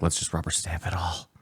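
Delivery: whispering. Retold or imitated. Quoting the official, whispered.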